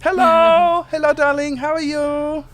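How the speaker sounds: squeaky little genie gushing over Titus; small Germanic genie. Acted. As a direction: play a tiny fussy genie, flustered.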